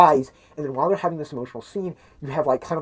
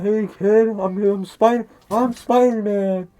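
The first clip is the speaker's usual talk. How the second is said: slow, higher hammed-up Spider-Man greeting; mock hero voice; 5 semitones higher, 5 dB louder, slower than usual. Spider-Man greeting the Make-A-Wish kid, slow mock hero voice. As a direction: play the big hero, drawn out and a bit louder.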